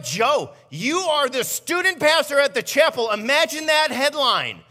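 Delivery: high, loud voice of the wife scolding; wife lecturing her husband in the car, high and loud; mock-scolding wife. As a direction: play the exasperated wife, hammed up. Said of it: "She's like".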